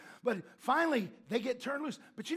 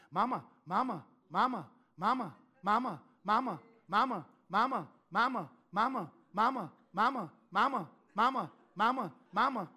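put-on a child nagging mama over and over, loud; whiny kid calling; 4 dB louder than usual. Niece calling her mom on the phone, repetitive loud whine. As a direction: keep calling like an ignored little kid.

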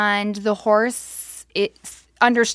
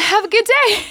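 much higher and louder fake-cheerful farewell; forced cheery goodbye; 13 semitones higher, 6 dB louder than usual. Leaving the neighbor with a broken foot, mock cheery goodbye. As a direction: chirp it while hiding the pain. Hiding pain, fleeing.

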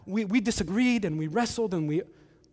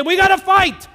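much higher, much louder mock battle cry; mock rallying shout; 12 semitones higher, 13 dB louder than usual. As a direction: yell it like an online call to arms. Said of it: "post something on Facebook and say".

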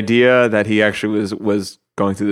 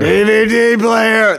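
very high, drawn-out cry; hammed-up catchphrase echo; 12 semitones higher, 4 dB louder, much slower than usual. Echoing a friend's quote from the show, hammed-up catchphrase. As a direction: stretch every word way up high, hamming it up.

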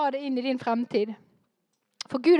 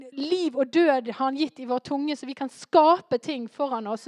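usual speaking voice

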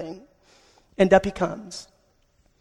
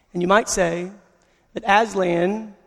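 normal talking voice

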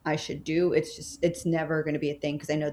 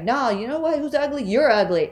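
mom snapping back; 8 semitones higher, 6 dB louder than usual. Retold or imitated. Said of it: "My mom was like".

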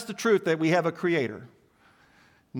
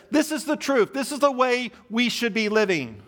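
high, mocking voice for the devil's claim; quoting the devil's lie; 6 semitones higher than usual. Preacher voicing the devil's claim, pitched high and mocking. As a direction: sell the lie brightly, like a tempter.

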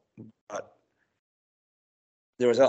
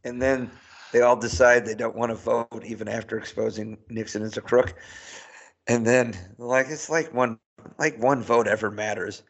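ordinary delivery

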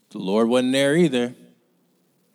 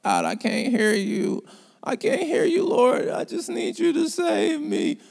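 much higher mock whiny plea; mock crying plea. Mocking people who cry to God they cannot hear. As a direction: whine it like a begging crybaby. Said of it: "we just cry, cry about".

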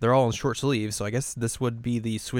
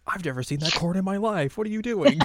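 baffled reaction; 7 semitones higher than usual. Retold or imitated. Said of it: "quoting bandmates' baffled reaction".

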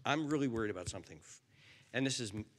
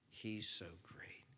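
slow whisper; 4 semitones lower, 11 dB quieter, slower than usual. Real, not acted. Awed reassurance.

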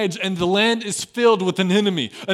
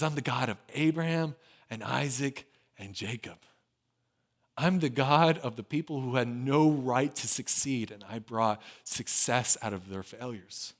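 softer, lower, drawn in close; hushed tender God voice; 7 semitones lower, 10 dB quieter than usual. Acted. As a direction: say it gently, like a quiet reassurance. God's answer, hushed and intimate.

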